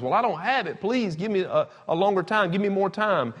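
high-pitched plea for time; acted pleading.

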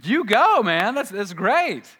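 high, loud mock cheer; imitating an excited fan. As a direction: cheer on the big winner, hammed up. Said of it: "we're like".

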